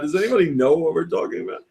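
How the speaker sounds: ordinary talk, nothing marked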